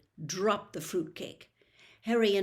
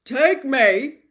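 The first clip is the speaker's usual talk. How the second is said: frightened cry, much louder and higher; trembling; 5 semitones higher, 14 dB louder than usual. Acted. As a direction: Hagrid shaken with dread. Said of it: "who was trembling".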